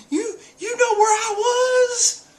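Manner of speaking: very high, drawn-out tearful wail; hammed-up sobbing outrage; mock crying. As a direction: stammer and wail it in despair. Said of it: "like, crying".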